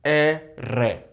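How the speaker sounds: "R" as erre in two separate syllables; rolled double R. Shown as a demonstration. Breaking down the letter name, trilled "R". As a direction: say "R" as eh, then trilled rreh. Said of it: "here is the double R spelling".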